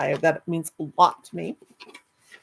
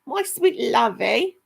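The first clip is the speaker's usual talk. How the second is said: slow high endearment; 5 semitones higher, slower than usual. Real, not acted.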